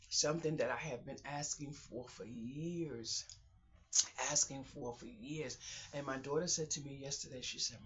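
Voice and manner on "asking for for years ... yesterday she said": ordinary talk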